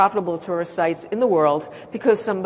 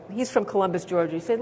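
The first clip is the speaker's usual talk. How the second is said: ordinary talk, nothing marked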